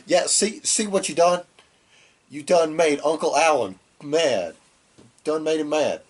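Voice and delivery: mock gloating Carl. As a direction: gloat like a smug uncle rubbing it in.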